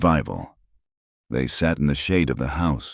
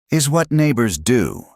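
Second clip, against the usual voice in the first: firm; 8 semitones higher than usual. A narrator's reading. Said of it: "Ingrid interrupted firmly".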